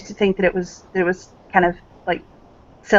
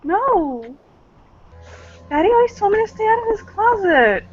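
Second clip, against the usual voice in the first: innocent daughter; 13 semitones higher than usual. Acted. Player voicing naive daughter.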